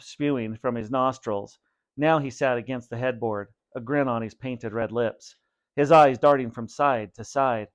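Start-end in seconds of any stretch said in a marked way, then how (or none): none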